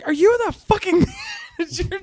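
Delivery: high mock yell with a laugh breaking in; mock angry wife. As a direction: shriek the demand while cracking up. Wife confronts him as manager.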